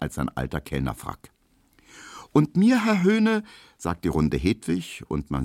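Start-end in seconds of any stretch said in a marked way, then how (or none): 2.3-3.4 put-on torn girl. Hedwig ordering, struggling with herself.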